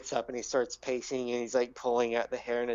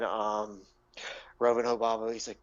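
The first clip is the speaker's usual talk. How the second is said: ordinary delivery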